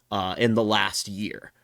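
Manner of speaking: ordinary delivery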